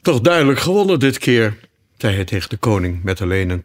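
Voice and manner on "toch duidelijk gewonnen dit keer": lightly voiced unicorn, higher and louder; boastful unicorn. A narrator's reading. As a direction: crow lightly over a win, raised pitch.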